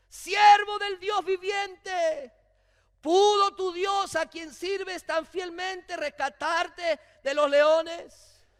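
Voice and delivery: slow, high, loud pleading call; a king's desperate shouted question; anguished call. Acted. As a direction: call down into the den, slowly and desperately. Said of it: "gritó con angustia".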